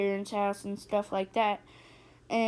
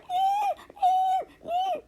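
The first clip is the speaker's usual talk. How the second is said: high, whiny fake sobbing; mock crying eh eh eh; imitated crying; much slower than usual. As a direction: wail like a little kid running home. Sister runs home crying.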